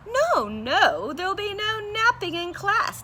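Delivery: high, loud bossy chirp; declaring bird. Acted. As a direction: lay down the rule, little teacher. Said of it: "declared the bird".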